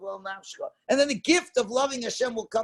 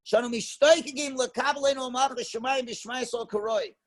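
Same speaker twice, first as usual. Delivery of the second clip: ordinary talk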